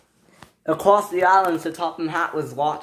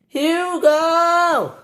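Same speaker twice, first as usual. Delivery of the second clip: calling out; 14 semitones higher, 4 dB louder than usual. A narrator's reading. Calling an engine far away.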